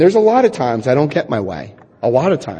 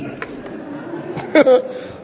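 breathy laugh; 10 semitones higher, 5 dB quieter than usual. Real, not acted. Laughs at own old thinking.

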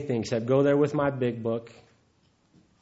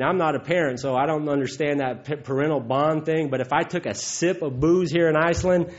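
usual speaking voice

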